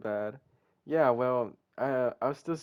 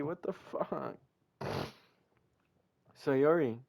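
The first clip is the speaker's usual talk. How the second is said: ordinary talk, nothing marked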